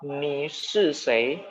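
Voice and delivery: Ni shi sei said very slowly, syllable by syllable; teacher modelling a Mandarin question slowly; slow example sentence. Shown as a demonstration. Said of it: "saya ulangin ya".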